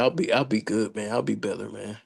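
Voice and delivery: ordinary talk, nothing marked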